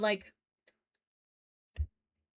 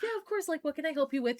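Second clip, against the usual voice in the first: eager customer-service voice, pitched much higher; people pleaser; 7 semitones higher, faster than usual. Retold or imitated. Teen worker greeting a rude tourist, overly eager and high. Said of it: "trying to be a people pleaser".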